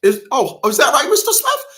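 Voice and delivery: hammed-up surprised judge; mock judge. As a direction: imitate the judge's surprised question. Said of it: "the judge said".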